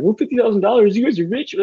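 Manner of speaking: high, loud mock-outraged voice; mocking imagined critics. Imitating people who think they're rich, mocking. Mimics people scoffing at the amount.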